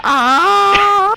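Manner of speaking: very high shrieking cry; mock the dying scream loudly; imitated death cry. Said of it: "Y'all watching this heard that".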